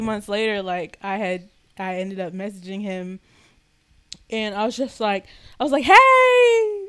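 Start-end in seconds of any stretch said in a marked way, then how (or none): 5.8-6.8 high, loud little hey; a nervous squeak of a greeting; squeaky hey. Retold or imitated. Reaching out to him after months, squeaky. Timid message to the boy.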